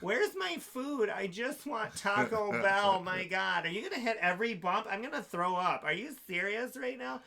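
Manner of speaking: high, whiny drunk impression with stretched words; mock a drunk complaining, swooping up in pitch; drunk ex-wife. Drunk wife berating husband in the car, high petulant whine. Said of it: "this is how she talks".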